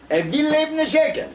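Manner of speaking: high, fast punchline; emphatic exclamation. Retold or imitated. Lecturer delivers the point of his story, emphatic exclamation. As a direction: exclaim it high and quick, driving it home.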